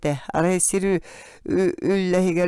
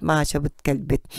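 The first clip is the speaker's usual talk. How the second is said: usual speaking voice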